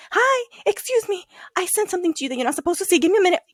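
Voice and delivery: high, sugary, hurried politeness; acting falsely casual in a high, quick voice; innocent cover-up. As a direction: chirp an urgent polite excuse.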